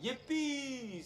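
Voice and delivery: high, drawn-out mock cheer; gleeful cheer. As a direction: squeal it like a delighted Moses. Moses cheers at being freed.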